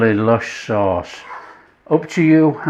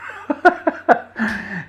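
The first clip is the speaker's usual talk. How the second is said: high laugh; 10 semitones higher, 3 dB quieter than usual. Real, not acted. Laughing at his own joke.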